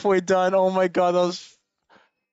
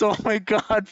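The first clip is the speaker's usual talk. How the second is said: ordinary talk, nothing marked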